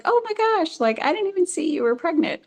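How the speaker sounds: high-pitched mock gasp of surprise; shocked guard. Security guard realising the mother is pregnant, high shocked apology. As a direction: play the guard suddenly noticing the belly. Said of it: "the security guard is like".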